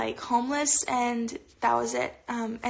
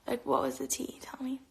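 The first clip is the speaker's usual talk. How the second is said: whispering; 8 dB quieter than usual. Real, not acted. Eager gossip request to viewers.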